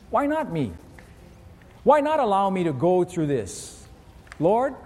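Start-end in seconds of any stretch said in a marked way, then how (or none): none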